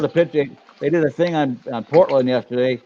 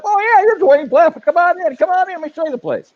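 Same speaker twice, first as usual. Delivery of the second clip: much higher, eager voice of a shop owner; welcoming shop owner; 3 dB louder than usual. Retold or imitated. Shop owner welcoming the well-known insurance agent inside, high and eager. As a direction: gush like an owner recognizing a familiar face.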